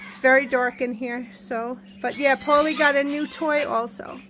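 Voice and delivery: ordinary delivery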